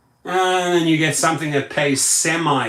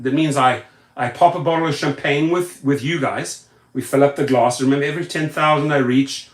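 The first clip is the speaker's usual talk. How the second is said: usual speaking voice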